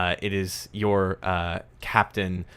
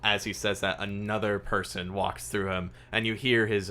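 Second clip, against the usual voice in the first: ordinary talk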